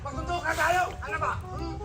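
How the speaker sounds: soft fighting challenge; quiet rehearsed insults; hushed mock threat. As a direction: dare them to fight under your breath. Rehearsing a challenge under his breath.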